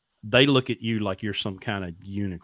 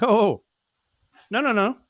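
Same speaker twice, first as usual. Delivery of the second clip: high-pitched, louder retold objection; alarmed protest; 11 semitones higher, 5 dB louder than usual. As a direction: cut in with rising alarm to stop them. Protests run being taken away.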